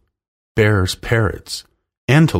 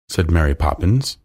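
ordinary delivery, nothing marked

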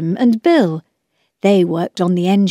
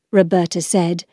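plain reading voice

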